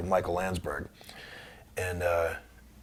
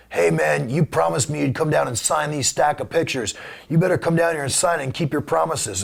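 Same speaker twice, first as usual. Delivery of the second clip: imitating the caller, a little higher and pushy; 6 semitones higher than usual. Prank caller demanding he come sign the pictures, pushy mock voice. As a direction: put on the nagging voice of the man on the phone. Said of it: "a voice on the other end that says".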